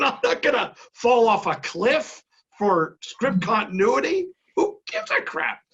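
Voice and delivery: high, exasperated rant climbing into a squeak; incredulous protest. Retold or imitated. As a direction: protest in disbelief, pitch shooting up at the end. Acted protest over script continuity.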